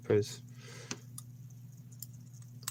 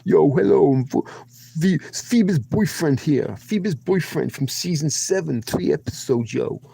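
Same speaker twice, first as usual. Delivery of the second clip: louder New York tough guy swagger; New York tough guy accent; 4 semitones higher, 3 dB louder than usual. Acted. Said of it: "voicing it over, like, my voice".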